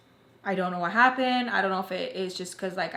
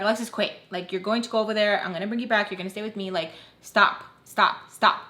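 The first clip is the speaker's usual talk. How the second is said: ordinary talk, nothing marked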